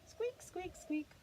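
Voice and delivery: very high mouse squeaks; squeaking mouse. Pretend. Reading to a child, mouse squeaks high. Mouse noise in bedtime book.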